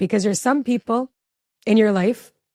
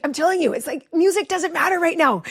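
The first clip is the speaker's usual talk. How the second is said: tense high-pitched scolding; 7 semitones higher than usual. Retold or imitated. Mom retelling telling her teen driver off about music, tense and high. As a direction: re-enact an anxious mom laying down the law. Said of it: "high, like, pitch voice".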